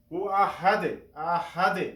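আহাদে with the final D bounced into a short echo; qalqalah echo. Shown as a demonstration. Teacher shows the returning sound, qalqalah bounce. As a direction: release the last D of আহাদে with a rebound. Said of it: "পুনরায় যে একটি আওয়াজ আসে".

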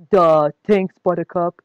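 mild dim-witted mumble, barely changed; lightly mock the slow farm boy; dumb Wesley. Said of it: "the kind of thing Wesley came out with".

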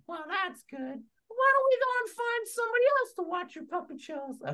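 very high cheerful puppet voice; Char Char encouraging. Acted. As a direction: cheer up the friend in a high squeak. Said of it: "Hey, Char Char".

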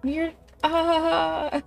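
very high, slow laughing voice; high giggly voice breaking into laughter; laughing in character. Pretend. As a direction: laugh through the line. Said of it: "He's just like".

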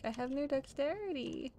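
high, drawn-out mock wail; playful lament. Dinosaur player asked to shake hands, mock lament. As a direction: stretch it into a comic high complaint.

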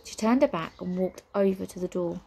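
ordinary delivery, nothing marked

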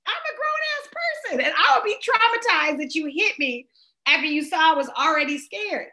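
high-pitched, hammed-up upset complaint; acted indignant protest. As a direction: protest in mock distress at being hit. Acts out traumatized victim's protest.

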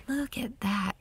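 hushed whisper in wonder; awed whisper. Real, not acted. Gardener marveling at the morning sky in a whisper.

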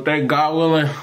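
ordinary delivery

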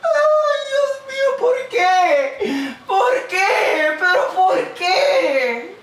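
wailing and sobbing high; lamento llorando. Real, not acted. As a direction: cry out in despair. Llora por Brasil eliminado. (lamento entre sollozos)

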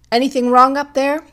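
high, loud call upward; shout from below. A narrator's reading. Policeman calling up to a couple at their window, shouted. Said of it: "broken by a shout from below".

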